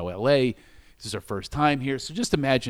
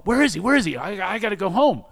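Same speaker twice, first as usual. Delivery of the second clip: high, loud fretting, re-enacted; retold panic; 7 semitones higher, 5 dB louder than usual. Man hunting for his missing companion, in retold panic. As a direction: replay your panic, pitched up and urgent. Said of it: "I'm shaking".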